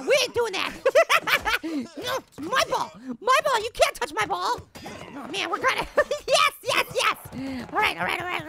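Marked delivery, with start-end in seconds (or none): none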